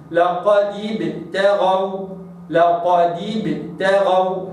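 لقد ابتغوا slow, dal with clear kasra; Quranic recitation. Shown as a demonstration. Teacher models لقد ابتغوا slowly. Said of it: "هكذا".

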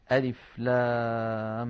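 drawn-out chanted letter names; slow recitation of letters. Shown as a demonstration. Teacher begins reciting the opening letters, slow chant. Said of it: "reading letter names".

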